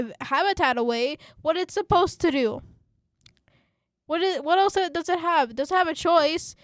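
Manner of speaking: loud, high-pitched exasperated questioning; indignant outburst, pushing pitch and volume up; heated rant. Real, not acted. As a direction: demand answers, really worked up. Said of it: "that rant".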